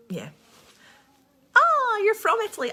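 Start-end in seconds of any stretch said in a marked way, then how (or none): 1.5-2.7 bursting out high and loud at the news; light up, thrilled to read it; excited, much higher and louder; delighted surprise. Real, not acted. Excited reaction to viewer comment.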